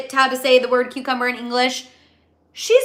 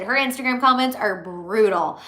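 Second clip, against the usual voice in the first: usual speaking voice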